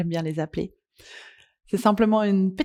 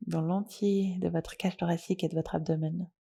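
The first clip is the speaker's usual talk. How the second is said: soft, unhurried yoga nidra guidance with long pauses; guided meditation; 5 dB quieter than usual. Acted. Leads a guided relaxation.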